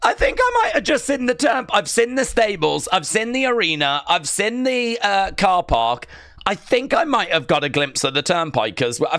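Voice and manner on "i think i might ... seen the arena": high, breathless excitement; can hardly believe he sees it; excited. Real, not acted. Excitedly spotting the venue.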